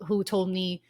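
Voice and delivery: ordinary talk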